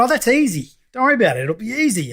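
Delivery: high, sing-song mock dismissal; breezy reassurance. Client waving off website advice, breezy and high. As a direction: imitate a naive, carefree brush-off, pitched well up. Retells own naive brush-off.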